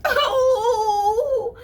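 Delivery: high, loud crying oh; squenchy whimper. Acted. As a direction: whimper like a squeezed rabbit. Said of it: "in his little squenchy voice".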